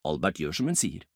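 ordinary delivery, nothing marked

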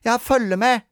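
high, loud sulky retort; put-upon child's protest. A narrator's reading. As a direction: answer back like a huffy child. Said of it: "sa sure Sara".

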